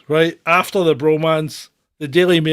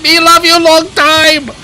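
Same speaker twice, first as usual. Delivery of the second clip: high, loud mock North Korean accent; poor North Korean accent; 11 semitones higher, 9 dB louder than usual. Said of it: "Your poor North Korean accent".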